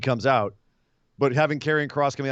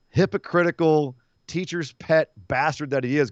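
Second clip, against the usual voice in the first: usual speaking voice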